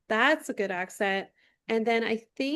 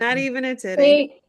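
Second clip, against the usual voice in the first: louder playful quote; playful catchphrase; 3 semitones higher, 9 dB louder than usual. Retold or imitated. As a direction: toss out the in-joke loudly. Repeating their inside-joke quote, louder.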